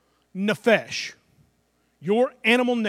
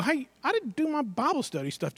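sudden realization; 5 semitones higher, 6 dB quieter than usual. Retold or imitated. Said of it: "and I go".

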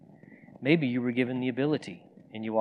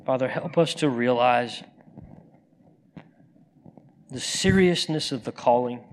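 fervent prayer; 3 dB louder than usual. Acted. Preacher closing the service with a fervent prayer to God. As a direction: pray it over the people, rising and falling.

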